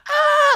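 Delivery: very high, loud shriek; high scream. Retold or imitated. Friend at the table screaming over his crying, shriek. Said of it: "scream for maybe".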